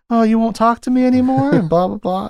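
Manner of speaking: hammed-up high lady pleading with a silent clown; swooning lady voice. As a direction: gush like a lovesick society woman. Teasdale voiced in retold scene.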